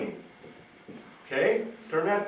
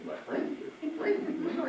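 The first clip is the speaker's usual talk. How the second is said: teasing, stuttered echo of a repeated phrase, voiced lightly; mocking a stutter; 13 semitones higher, 5 dB quieter, slower than usual. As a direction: tease the stammer by repeating the start. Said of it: "Stutters a little, don't he?".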